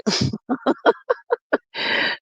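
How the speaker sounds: soft, breathy run of laughter; real chuckling laugh at a comment. Real, not acted. Streamer laughs on reading "still laughing at you", amused chuckling.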